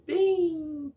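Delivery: high, drawn-out "bem" before "suave"; gentle emphasis. Shown as a demonstration. Teacher describing the kitten's sound, gently stretched "bem".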